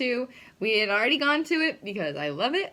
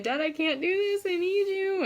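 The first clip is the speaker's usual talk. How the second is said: higher, imitating a homesick plea; pleading to dad; 10 semitones higher than usual. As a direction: beg dad for help in a mock-small voice. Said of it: "I called my dad being like".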